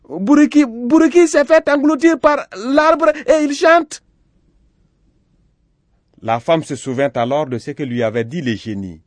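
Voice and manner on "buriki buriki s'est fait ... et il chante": put-on very high, loud child voices; high-pitched, shouted alarm; alarmed children. A narrator's reading. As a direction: blurt the news at the top of your voice. Children report the alarming news.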